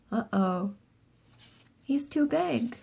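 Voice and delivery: plain reading voice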